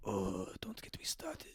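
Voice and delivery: breathy whisper, dropped right down; hushed whisper. Retold or imitated. A boss whispering his gripe to the worker. Boss's weary reply retold.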